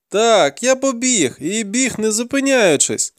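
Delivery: high-pitched denial; pleading captive boy. A narrator's reading. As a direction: protest in a high boy's voice. Captive boy confesses in panic.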